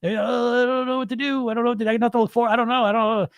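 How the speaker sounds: high, lost and whiny, mocking a panicked dieter; panicky dieter voice. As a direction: play the helpless dieter who has no idea what comes next. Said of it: "Now you're fucked".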